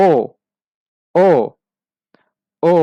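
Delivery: "ও" modelled as the umlaut vowel; O with two dots, drilled as "ও"; German O-umlaut. Shown as a demonstration. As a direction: repeat "ও" as a short isolated vowel. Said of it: "এটার pronunciation হবে".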